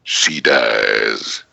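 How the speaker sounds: low, soft, slow menacing line; deep villain voice. Shown as a demonstration. Actor leaning into a fan's ear, deep soft villain. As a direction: lean in and breathe the threat slowly.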